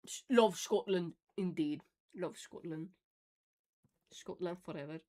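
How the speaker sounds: a light put-on Scottish accent; mock Scottish accent, fond and playful. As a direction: play a proud Scot, lightly. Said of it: "the accent makes it so good".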